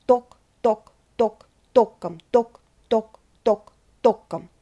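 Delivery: Tok said short and dry like hooves clopping; цокот копыт; horses' hooves. Shown as a demonstration. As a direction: clip each tok like a hoof on stone. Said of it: "Imitating the sound of horses' hooves".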